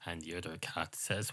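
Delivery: normal talking voice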